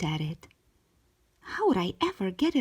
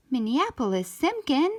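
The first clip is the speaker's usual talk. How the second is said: mom yelling name; 6 semitones higher, 3 dB louder than usual. A narrator's reading. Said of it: "Mom was yelling for me".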